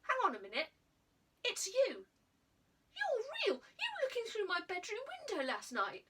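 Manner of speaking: put-on boy recognising the girl from his window, astonished; dawning shock. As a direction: realise it's her and blurt it out. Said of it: "his eyes widening".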